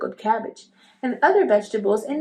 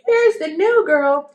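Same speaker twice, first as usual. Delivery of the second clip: loud, higher and slow, announcing to the room; loud announcement; 8 semitones higher, 7 dB louder than usual. A narrator's reading. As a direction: proclaim it to the whole class. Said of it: "he announced so loudly".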